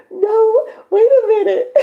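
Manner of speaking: high, loud squeals of disbelief; thrilled no way squeal; excited squeal. Real, not acted. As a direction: shriek with delighted surprise. Excited reaction to new subscriber.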